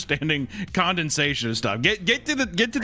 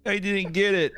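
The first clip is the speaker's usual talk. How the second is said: higher, mock-tearful kid; mock whining; 7 semitones higher than usual. Co-host mimicking crying fourth grader, whining. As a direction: imitate a whimpering pupil.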